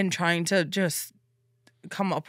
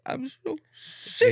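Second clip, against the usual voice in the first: whiny sick voice; 8 semitones higher, much slower than usual. Retold or imitated. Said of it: "she's like".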